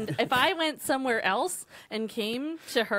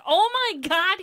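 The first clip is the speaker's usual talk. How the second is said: surprised realization; 7 semitones higher, 6 dB louder than usual. Retold or imitated. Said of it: "she's like".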